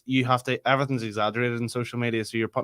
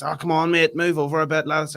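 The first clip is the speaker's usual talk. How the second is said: higher, louder mock pushy lad at the bar; imitating pushy bloke; 5 semitones higher, 4 dB louder than usual. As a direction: ape the bloke pushing in behind. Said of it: "started doing, like, this".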